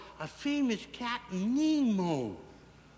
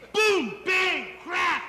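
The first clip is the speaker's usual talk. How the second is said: loud, high exclaimed impact words; crash noises; 7 semitones higher, 6 dB louder, much slower than usual. Acted. Storyteller acts out submarine hitting battleship, shouted crash noises. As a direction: punch out each crash like an explosion.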